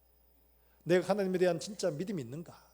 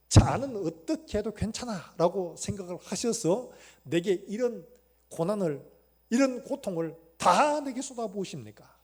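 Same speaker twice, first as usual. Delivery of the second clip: loud, slow pleading complaint; Job's anguished lament; 4 semitones higher, 9 dB louder, slower than usual. Retold or imitated. Job complaining to God about his suffering, loud anguished plea. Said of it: "이런 거예요, 지금".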